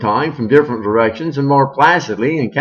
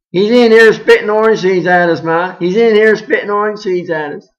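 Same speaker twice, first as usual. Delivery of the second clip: put-on high loud tattling kid; tattling child; 8 semitones higher, 6 dB louder than usual. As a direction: tattle to mother loudly.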